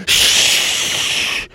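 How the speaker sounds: sharp hiss for silence; stern shush; librarian shush. Acted. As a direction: hush them hard. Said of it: "the librarian said".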